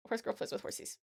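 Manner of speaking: quick, soft read-back; reading back quietly. Real, not acted. Reads finished title back to self.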